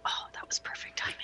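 soft whispered aside; whispering. Real, not acted. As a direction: whisper it under breath. Whispered tactical talk.

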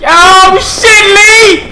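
high startled yell; alarmed shout. Real, not acted. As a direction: shout the name in a panic. Sudden alarm calling teammate.

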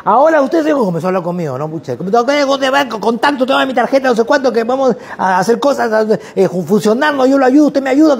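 put-on high, rushed, overeager young salesman; eager salesman. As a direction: pitch the shared taxi like a pushy go-getter. Student pitches to the narrator.

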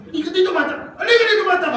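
imitating a mother scolding her hurt child, loud and high; mother yelling. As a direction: yell at the kid like an angry mom.

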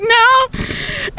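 high, laughing refusal; giggling no. Retold or imitated. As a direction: squeal it through a laugh. Laughing refusal in retold story.

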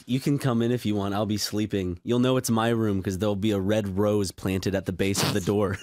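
low-pitched, lightly flirtatious invitation; playful low come-on; mock flirty. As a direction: pretend to hit on him, pitched low. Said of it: "flirty little, like, things".

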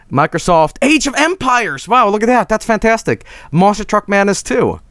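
high-pitched, eager discovery; delighted excitement. Real, not acted. Streamer spotting favorite old games on a disc, excited. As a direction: exclaim each game title with delight.

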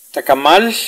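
Tacamal ending in a voiceless, hushed L; hissed final L. Shown as a demonstration. Teacher models the Kaqchikel final L, Tacamal with hushed L. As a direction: let the last L of Tacamal turn to airy friction. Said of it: "la L final se pronuncia".

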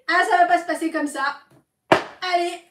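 outraged and high; indignant outburst. Real, not acted. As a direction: blurt it out loudly, refusing to let it go. Indignant after being hung up on.

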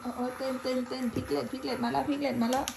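high loud chanting cheer; excited cheering. Real, not acted. Cheering kids dancing and spinning.

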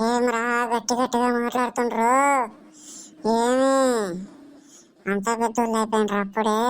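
ghostly, stretched-out scolding; feigned possession. Pretend. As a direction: drag out the line endings like a possessed woman. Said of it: "దయ్యం పట్టిన లాగా ఏం అరుస్తున్నావు?".

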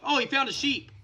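ordinary talk, nothing marked

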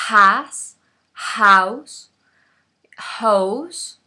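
"house" with a breathy h like a Spanish j; aspirated h. Shown as a demonstration. Teacher showing the English h to Spanish speakers. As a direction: breathe out hard at the start of "house". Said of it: "como sacando aire".

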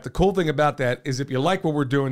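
ordinary delivery

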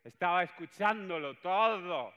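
high, loud, slow Chiquito-style voice; Chiquito impression. Boss revealing he overheard everything, loud slow Chiquito-style voice. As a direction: stretch it out like Chiquito. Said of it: "Chiquito, ¿no?".

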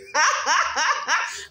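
loud, high burst of ha-has; high gleeful cackle; teasing laugh. Real, not acted. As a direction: laugh out loud at your own prank. Laughs while teasing viewers.

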